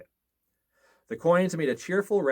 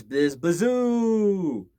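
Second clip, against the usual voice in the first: very slow, high, loud howling wind; eerie drawn-out wind; stretched wind howl; 8 semitones higher, 6 dB louder, much slower than usual. A narrator's reading. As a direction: drag out the storm's howl. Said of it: "the wind blew".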